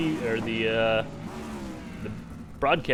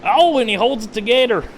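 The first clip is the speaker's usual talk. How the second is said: hammed-up Southern race announcer, louder; fake Southern accent; 3 dB louder than usual. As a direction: play a good old boy calling the race in a fake Southern accent. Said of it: "Do a good Southern accent".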